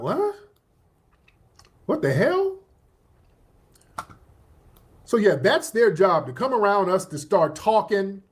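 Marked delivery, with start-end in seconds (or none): 0.0-2.6 high, squeaky what-the-hell disbelief; shocked disbelief. Retold or imitated. Us hearing them talk, shocked and disbelieving. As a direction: jump way up in mock shock. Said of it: "And we're like".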